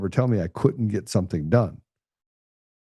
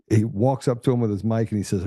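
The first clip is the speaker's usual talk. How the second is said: usual speaking voice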